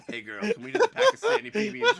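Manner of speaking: high squeal through laughter; cracking up, shrieking the line. Real, not acted.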